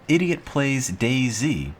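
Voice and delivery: slow, drawn-out title read; slow mock title voice; funny title. Shown as a demonstration. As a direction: read it out slowly for a laugh. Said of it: "Make it funny".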